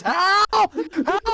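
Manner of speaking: high-pitched mock scream for help; imitated cry for help. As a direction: yell it like a voice ringing through empty streets. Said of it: "all you're hearing is".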